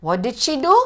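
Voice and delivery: usual speaking voice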